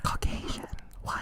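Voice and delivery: soft breathy whisper. Acted. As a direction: whisper it like a secret. Joking whispered word.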